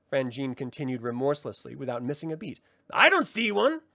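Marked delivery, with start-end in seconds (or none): 2.9-3.8 high, theatrical sprite voice; mocking brownie. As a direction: tease him with mock ceremony. Frangine scoffs.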